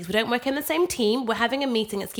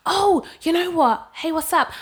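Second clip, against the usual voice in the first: chirpy colleague; 7 semitones higher, 4 dB louder, slower than usual. Retold or imitated. Colleague on the stairs.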